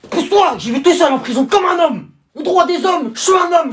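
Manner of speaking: high, loud manly boast; loud proud declaration. Acted. Dad marching off to prison, loud and proud. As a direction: announce it with chest out.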